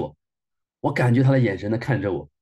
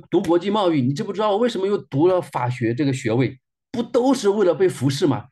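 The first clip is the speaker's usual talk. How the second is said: higher, building accusation; anguished protest; 6 semitones higher than usual. Retold or imitated. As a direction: pour out a bitter grievance. Weeping rant against God.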